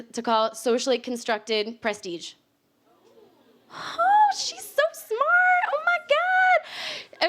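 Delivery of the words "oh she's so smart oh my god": very high mock-swooning gush; high-pitched hammed-up fangirl awe; gushing admirer. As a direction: squeal in exaggerated, drawn-out awe. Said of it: "you're like".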